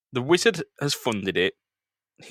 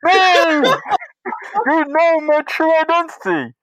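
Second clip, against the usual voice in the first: high, loud cartoon-villain shrieks; exposed villain; 6 dB louder, slower than usual. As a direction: shriek in horror at being unmasked. Villain exposed on call-in show.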